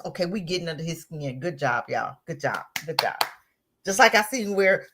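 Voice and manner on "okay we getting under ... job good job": lower, quieter smug pat on the back; smug mock congratulation. Mocking them congratulating each other, low and smug. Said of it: "They love just".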